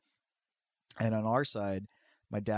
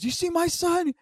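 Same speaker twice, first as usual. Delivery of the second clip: high mock threat. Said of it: "he's just yelling shit".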